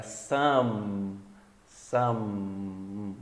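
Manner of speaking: some ending in a long hummed M; closed-mouth final M. Shown as a demonstration. Teacher says shut the mouth, some with a held final M. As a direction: close the lips and sustain the end of some. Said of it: "Então fecha a boca".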